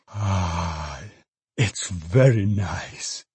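slow, drawn-out sigh of relish; old father savouring. A narrator's reading. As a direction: linger over the smell.

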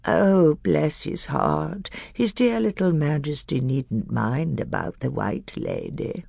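put-on wheedling old hag, soft, slow and creepy, whispered at times; shrill whining hag. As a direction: fawn and wheedle like a sly old crone. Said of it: "the shrill whining voice began".

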